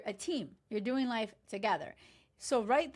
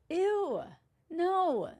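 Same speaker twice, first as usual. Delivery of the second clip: high, loud ew and no; flat refusal in disgust; grossed-out rejection; 7 semitones higher, 3 dB louder than usual. Real, not acted. Rejecting Devontae.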